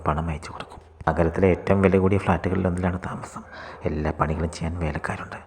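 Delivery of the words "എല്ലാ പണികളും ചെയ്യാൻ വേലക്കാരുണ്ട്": ordinary delivery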